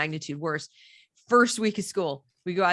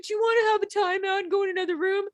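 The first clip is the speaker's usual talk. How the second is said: very high, quick mock-coddling voice; sugary high-pitched imitation; cooing principal; 11 semitones higher, faster than usual. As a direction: imitate an adult babying a child. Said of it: "It was, like, hugging him".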